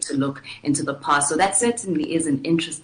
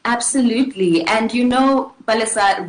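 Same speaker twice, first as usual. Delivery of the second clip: emphatic agreement; 5 semitones higher, 7 dB louder than usual. Real, not acted.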